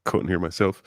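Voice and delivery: ordinary delivery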